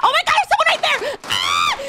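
high, loud, fast shriek; panicked scream. Real, not acted. Gamer spots someone right there, panicked screaming. Enemy appears while reading chat.

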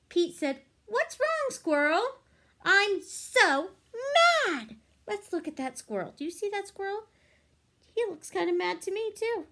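bright, lively storybook reading; reading to children. A narrator's reading. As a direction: read it like a picture book for kids. Reading picture book aloud.

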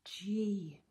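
usual speaking voice

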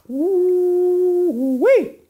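long, loud, high-pitched "woo-wee"; excited whoop. Real, not acted. Pastor celebrating the rebuilt wall, excited whoop. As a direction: let out a sustained high holler.

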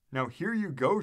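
usual speaking voice

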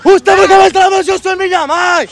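put-on angry challenge; feigned outrage. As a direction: confront them loudly, pretending offence. Prankster stages an angry confrontation.

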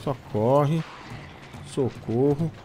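urgent panicked calls to run; being chased. Real, not acted. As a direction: yell run, run while fleeing. Fleeing monster, shouting run.

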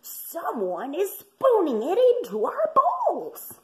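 high, eager puppy voice; yappy panting puppy. A narrator's reading. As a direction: gush it like an excited puppy. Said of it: "they cried".

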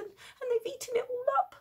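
put-on high whiny child. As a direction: pipe up like a small kid. Little person continues.

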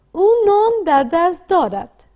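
slow read-aloud model. Shown as a demonstration. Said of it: "با صدای بلند بخوانید".

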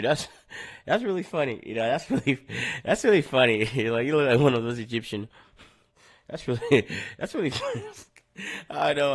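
words broken up by laughter; can't stop laughing. Real, not acted. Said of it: "I can't stop laughing".

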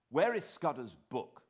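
sudden eager cry; cried out. A narrator's reading. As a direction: call for the book at once. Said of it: "I cried to Sir Walter".

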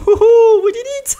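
high loud victory cheer; excited cheer. Real, not acted. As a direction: shout with triumph high.

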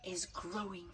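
a soft hushed whisper; storyteller's whisper. Acted. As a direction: lean in and whisper it.

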